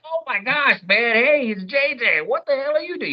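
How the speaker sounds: high, excited starstruck gush; giddy fan. Retold or imitated. Meeting J J from Good Times, high giddy fan gush. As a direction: gush like a kid meeting his TV hero. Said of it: "giddy, like".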